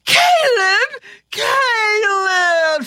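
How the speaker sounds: very high, drawn-out shriek. Acted. Kate recognizing Caleb, shrieking. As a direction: shriek the name, stretching it out. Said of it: "she shrieked".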